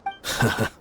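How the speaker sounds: a short, amused, breathy chuckle; breathy laugh. Acted. Gang member chuckling at Dia joining at the last moment. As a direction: huff a quick laugh of amusement.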